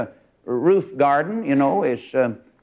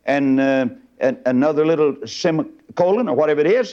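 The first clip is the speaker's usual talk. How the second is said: ordinary delivery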